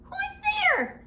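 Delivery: very high squeaky mouse cry; tiny frightened squeak. Acted. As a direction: squeak out the question. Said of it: "Mouse cries".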